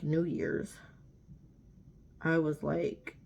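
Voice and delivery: usual speaking voice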